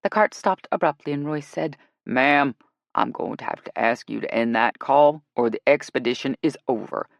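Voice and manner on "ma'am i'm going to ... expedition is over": low, firm male-guide voice; stern guide. Acted. Guide threatening to end the expedition, low and stern. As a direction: lay down the law in a deep voice. Guide ordering the call ended.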